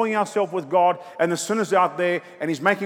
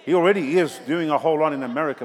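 ordinary talk

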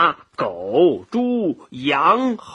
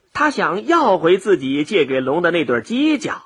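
ordinary delivery

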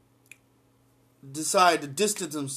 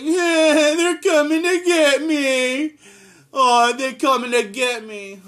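much higher, louder fake sobbing in a bad New England accent; crying mock whine; 8 semitones higher, 8 dB louder, slower than usual. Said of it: "I'm trying to do his really bad New England accent".

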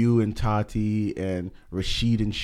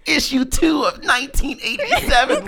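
nerdy mock voice; 13 semitones higher, 3 dB louder than usual. Mock weeb quoting comic issue.

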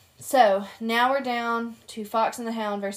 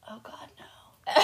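slow whispered dismay; slow whisper of dread; horrified whisper; slower than usual. Retold or imitated. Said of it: "whispered reaction".

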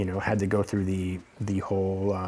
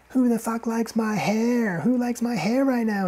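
slow, high-pitched stage call; high mock onstage holler; comedian working the crowd; 14 semitones higher, 3 dB louder than usual. Imitates comedian shouting from stage.

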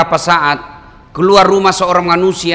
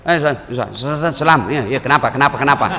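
mock stammered, incomplete phone greeting; hasty phone salam; 6 semitones lower than usual. Man answering the phone, mock hurried stammering. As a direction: stumble through the salam and rush to business. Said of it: "nggak ada yang sempurna itu".

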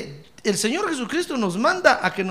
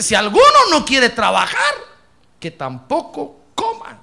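loud, ringing "Si alguno no quiere trabajar"; proclaimed loudly; 3 semitones higher, 8 dB louder than usual. Shown as a demonstration. Pastor thunders verse at congregation, loud and ringing. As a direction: proclaim the verse with full force.

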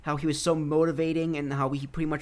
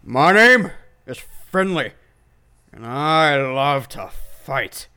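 slow, loud wrestler impression without the accent; Finlay; 7 dB louder, much slower than usual. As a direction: draw out a fighter's boastful introduction. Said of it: "didn't even put on an Irish accent".

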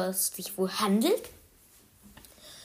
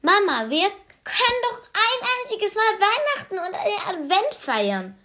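put-on high, laughing little boy voice; insistent child; 10 semitones higher than usual. Anton stamps back to his mother, high and laughing. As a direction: push for it like a stubborn toddler, laughing. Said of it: "er stampfte zu Mama".

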